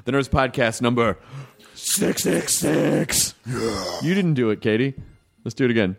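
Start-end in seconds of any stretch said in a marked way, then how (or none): 1.7-3.3 very slow, drawn-out devil voice. Acted. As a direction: drag it out like Satan. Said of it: "Katie's got a nice devil voice".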